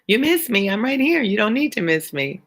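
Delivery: high, lilting teasing reply; high singsong banter with a viewer; playful reassurance. Real, not acted. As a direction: pitch it up, playfully fond. Playfully answering a viewer who misses her.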